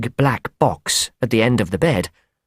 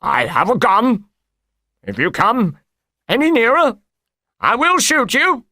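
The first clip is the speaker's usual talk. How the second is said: high-pitched, panicky threat in character; frightened voice; 11 semitones higher than usual. A narrator's reading. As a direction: threaten in fear, pitch climbing steeply. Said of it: "say in a frightened voice".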